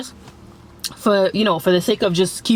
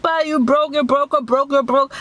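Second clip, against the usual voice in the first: mock yell; 7 semitones higher than usual. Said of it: "She's yelling".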